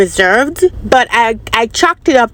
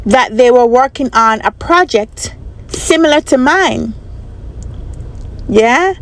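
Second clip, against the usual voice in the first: ordinary talk, nothing marked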